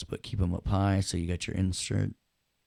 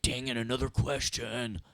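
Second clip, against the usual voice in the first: higher, softer mock student complaint; mock exasperation; 5 semitones higher, 5 dB quieter than usual. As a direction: grumble like a put-upon student, pitched up. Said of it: "You're like".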